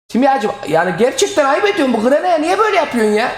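loud, high, pleading whine at the game; mock desperate plea. Real, not acted. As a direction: beg the upgrade to stop failing, half-joking. Mock plea to alchemy upgrade.

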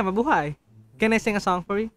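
ordinary talk

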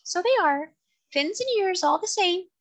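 very high, tiny creature voice; small, distinct, high-pitched voice; tiny seahorse voice. A narrator's reading. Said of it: "in a tiny but distinct voice".

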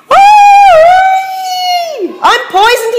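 very high, loud dingo howl. A narrator's reading. Dingo after a slurp of stew, howling. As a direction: wail it out like a poisoned dog. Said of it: "he howled".

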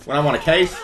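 hammed-up cop chewing someone out; half-laughing tirade; mock angry cop. Cop berating pedestrian in game.

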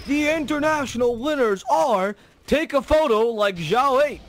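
slow, grand winner announcement; announcer voice. Pretend. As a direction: draw it out like a stage announcer. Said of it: "I want it to be like".

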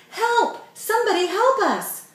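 very high, loud desperate scream; screaming for help. A narrator's reading. Mandy calling strangers for help, screaming. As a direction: scream across the parking lot.

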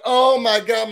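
high, delighted squeal of joy; mock thrilled gasp; mock gush. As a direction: gush like she's been flattered.

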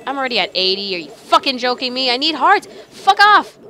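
exasperation building to a shout; real gamer rage, rising; angry outburst. Real, not acted. Losing health while playing.

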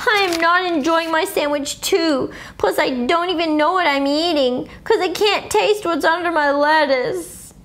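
put-on a touch of a grumbling kid; mild complaint. A narrator's reading. Herbert complains, nose held.

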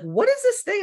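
high, loud, silly husband voice; imitating husband. Mocking Matt asking about coffee, squeaky and loud. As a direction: squeak it out like a clueless guy. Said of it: "he's like".

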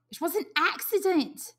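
put-on high boy's voice, blurting; sudden. A narrator's reading. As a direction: jump in quickly. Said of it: "said Piggy suddenly".

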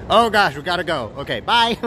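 high, loud hurry; hurried. Real, not acted. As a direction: rush off laughing. Rushing off to the queue.

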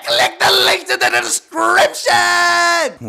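a high, loud, slow announcer-like call; outro shout. Real, not acted. Telling viewers to check the links, a high loud slow shout.

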